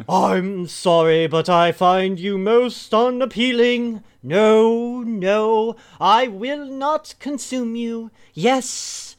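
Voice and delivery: high, loud, disdainful feline; lofty creature looking down on food; haughty cat voice. Acted. Cat character scolds a sub.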